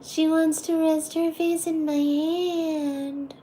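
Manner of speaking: high sing-song pet talk. Real, not acted. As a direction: coo to the cat. Baby talk to the cat.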